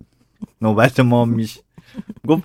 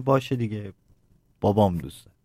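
mildly resigned, dropping lower at the end; resigned child; 6 semitones lower, 5 dB quieter than usual. Retold or imitated. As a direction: give in with a touch of resignation. Child giving in to mother.

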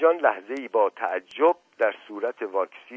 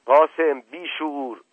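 loud, higher, drawn-out rebuke; angry scolding; 5 semitones higher, 6 dB louder, slower than usual. A narrator's reading. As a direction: scold a servant, stretching the words. Uncle scolds brawling servant. (سرزنش بلند و کشیده)

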